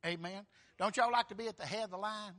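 ordinary talk, nothing marked

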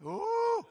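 high, loud drawn-out "ooh"; thrilled "ooh". Real, not acted. Preacher relishing Mary's encounter, thrilled "ooh". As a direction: let out a high whoop.

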